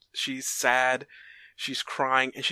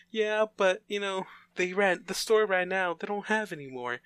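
put-on higher, light childlike voice; little girl's voice; 6 semitones higher than usual. Little girl tells Clara the store ran out, in a higher childlike voice.

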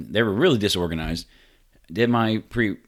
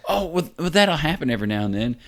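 mock breezy nurse; 8 semitones higher than usual. Mimics nurse brushing it off.

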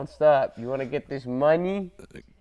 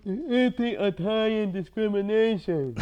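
Sopranos mobster voice; 6 semitones higher than usual. Retold or imitated. Sopranos impression, far timbre.

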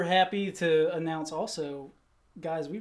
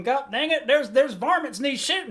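cowboy voice; 7 semitones higher, 4 dB louder than usual. Pretend. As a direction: play a rootin' tootin' cowboy. Hammy cowboy drawl about varmints.

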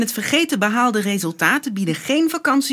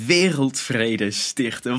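slow, awed repeat; dreamy echo; 11 semitones lower, 4 dB quieter, much slower than usual. Acted. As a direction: savour the idea slowly. Brother echoes peace plan dreamily.

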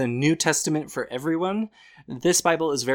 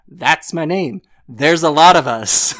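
louder, hammed-up line read; amused overblown scripture read; mock corny reading; 4 semitones higher, 12 dB louder than usual. Shown as a demonstration. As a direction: ham it up to show how corny it sounds. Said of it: "It just sounds so corny".

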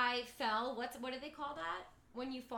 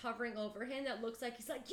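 usual speaking voice

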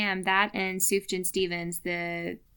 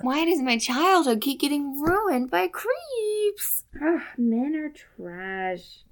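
mock despair; 6 semitones higher, slower than usual. Real, not acted. Mock despair over canceled host.

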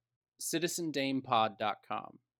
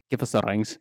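lightly put-on villain line, lower and louder; movie quote; 4 semitones lower, 7 dB louder, faster than usual. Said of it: "he said".

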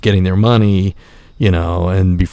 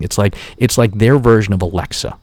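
ordinary talk, nothing marked

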